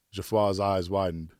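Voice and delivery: plain reading voice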